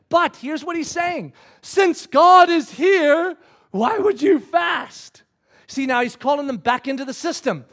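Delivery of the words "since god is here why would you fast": loud, slow, high rhetorical challenge; emphatic challenge. Acted. Preacher as Jesus challenging the Pharisees, loud emphatic challenge. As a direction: drive the question home word by word.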